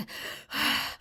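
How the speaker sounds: long audible exhale; calming breath. Acted. Frog calming himself over gum, heavy breath. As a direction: breathe out to steady yourself.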